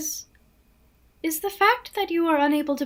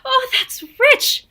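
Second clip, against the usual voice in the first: high, laughing exclamation; high amused outburst; delighted laugh; 8 semitones higher, 4 dB louder, slower than usual. Acted. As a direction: laugh it out, tickled. Laughing at scheme.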